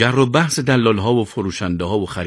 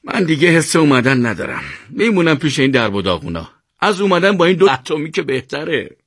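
higher-pitched, resigned grumble; weary sighing; 7 semitones higher than usual. A narrator's reading. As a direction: opt out with a weary sigh. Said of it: "آه سردی کشید و گفت". (غرغر خسته همراه با آه)

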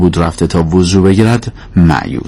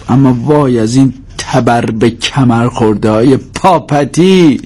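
higher-pitched complaint in place of a prayer; old grievance hurled up as prayer; bitter mock prayer; 5 semitones higher than usual. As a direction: lament aloud to heaven, dragging out the last words. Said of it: "به جای دعا گفت".

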